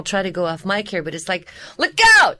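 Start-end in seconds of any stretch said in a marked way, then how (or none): 1.8-2.4 high, loud warning yell; sudden full shout of alarm; video game shout. Shown as a demonstration. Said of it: "we're doing video game stuff".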